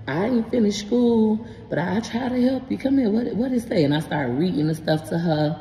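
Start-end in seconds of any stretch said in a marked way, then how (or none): 0.1-3.8 higher-pitched voice of a kindly elder; retold grandma voice, pitched up; great-grandma offering help. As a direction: imitate a great-grandmother coaxing a child over.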